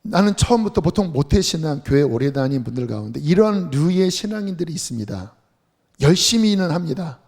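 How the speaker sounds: ordinary talk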